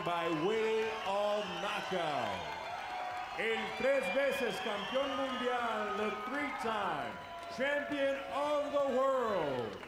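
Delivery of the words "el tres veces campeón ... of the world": stretched title call. Acted.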